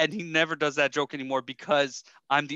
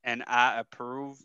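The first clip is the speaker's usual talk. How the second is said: slow, measured celebrity impression; Morgan Freeman; 3 semitones lower than usual. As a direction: attempt a solemn narrator, slow and slightly low. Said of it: "hear an impression of Morgan Freeman".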